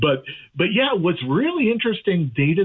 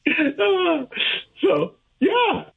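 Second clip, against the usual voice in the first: smiling voice; 13 semitones higher, much slower than usual. Real, not acted. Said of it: "You can hear the smile in my voice".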